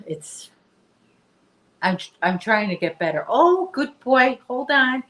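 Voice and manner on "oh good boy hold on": high, loud and slow praise for the dog; delighted praise. Real, not acted. Owner praising her dog Chester, high loud delighted voice. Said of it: "I have to praise him".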